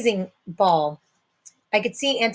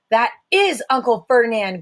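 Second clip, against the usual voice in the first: plain reading voice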